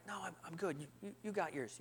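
very soft, under the breath; hushed refusal. Retold or imitated. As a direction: decline quietly during a service. Hushed exchange during service.

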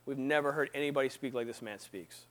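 low, soft acted reply; hushed awe. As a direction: answer quietly, as humbled guards. Guards answer the priests, acted.

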